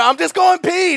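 high, loud mock excuse of a congregant sneaking out. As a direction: squeal it like someone caught leaving. Preacher acts out a congregant leaving.

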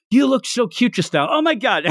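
mock-gushing squeal up high. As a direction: gush over him, voice up high. Gushing character quote.

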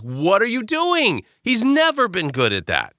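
high, slow, exasperated disbelief; exasperated yelling. Retold or imitated. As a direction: plead at the screen in disbelief. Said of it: "I'm like".

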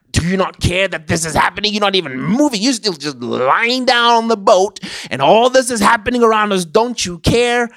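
shrill mock panic, fast then dragging on the last plea; freaking out. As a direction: play the disciples in a frantic panic at Jesus. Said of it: "wanting Jesus, to me, to freak out too".

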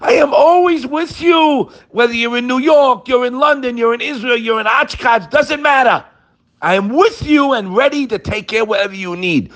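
high, booming divine proclamation; proclaiming as Hashem. Retold or imitated. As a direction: thunder the promise from the pulpit. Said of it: "Hashem has said".

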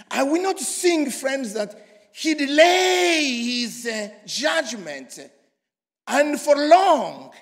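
loud, stretched-out preacher delivery; forceful preaching. Real, not acted. Preacher pressing the congregation on God's forbearance, forcefully. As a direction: drive each phrase home to the congregation.

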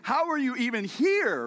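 high, loud mock shock; mock astonished shout at a friend's pothole story; shocked disbelief. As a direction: react like you can't believe they survived. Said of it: "It's like".

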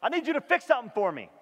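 high, loud call from the crowd; a man in a crowd yells to Jesus, loud and high; yelling out. Retold or imitated. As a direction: shout it over the crowd to get his attention. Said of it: "somebody yells out in the crowd".

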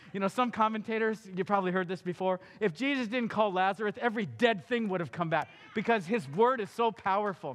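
loud, high sermon drive; fervent preaching. Acted. Preacher exhorting the church, loud fervent. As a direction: build the congregation up.

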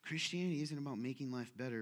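Christianity isn't about making life better, dropped low and soft; quiet musing repeat. Shown as a demonstration. Turning the idea over before the church, soft and low. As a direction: murmur it to yourself. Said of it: "What a weird, strange thing".

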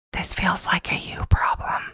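whispered aside to herself; soft, voiceless murmur of a private verdict; confiding whisper. Retold or imitated. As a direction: whisper it under your breath. Confides what she says to herself.

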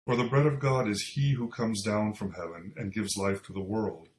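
normal talking voice

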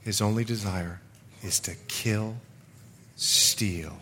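low, soft, grave warning; solemn preaching. Real, not acted. Preacher warns congregation about the enemy, solemn and hushed.

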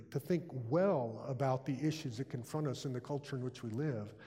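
soft, unhurried prayer voice, fading low; closing prayer. Acted. Pastor closing the chapel sermon in prayer, hushed and reverent. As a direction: pray gently over the congregation.

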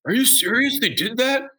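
put-on high, incredulous teen gasp; shocked students. Teacher imitating students shocked by Leviticus, high and incredulous. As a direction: squeak it out in disbelief.

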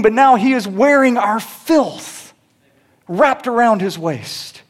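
high, loud and slow climactic preaching; preaching at full force. Acted. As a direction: drive the point home from the pulpit.

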